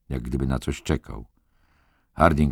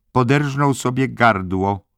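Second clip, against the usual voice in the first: higher-pitched, tense announcement; accusing head nurse; 9 semitones higher, 4 dB louder than usual. A narrator's reading. Head nurse telling McMurphy of the death, tensely accusing. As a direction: deliver it pointedly, voice lifted.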